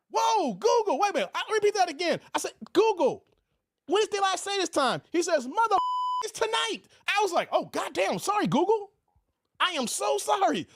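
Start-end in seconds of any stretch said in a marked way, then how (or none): 0.1-1.3 surprised. Retold or imitated. Retelling his startled reaction. 2.7-5.0 high-pitched. Retold or imitated. Retelling himself asking the phone again. 5.5-6.8 squeaky high-pitched assistant voice. Retold or imitated. Google voiced as a character. 7.5-10.7 shocked apology. Retold or imitated. Retelling himself apologizing in shock.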